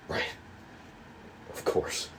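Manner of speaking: breathy whisper, no voice. Acted. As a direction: whisper it under your breath. Muttered reply after scolding.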